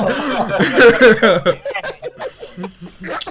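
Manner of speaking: a big rising burst of laughter; hearty laugh. Real, not acted. Laughs at the pecan prank.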